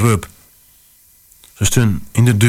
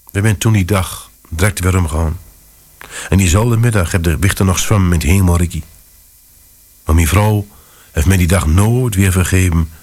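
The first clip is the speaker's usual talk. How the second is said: ordinary delivery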